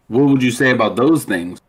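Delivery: ordinary talk, nothing marked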